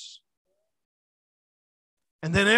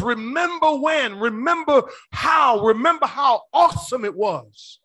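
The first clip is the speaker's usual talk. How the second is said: preaching the verse; 5 semitones higher, 6 dB louder than usual. Acted.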